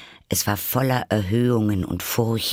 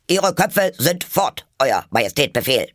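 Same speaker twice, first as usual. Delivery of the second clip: loud, raised military report; shouted reply; 5 semitones higher, 4 dB louder than usual. A narrator's reading. Soldiers reporting to the Queen, shouting. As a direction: shout it back to your sovereign. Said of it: "schrien die Soldaten als Antwort".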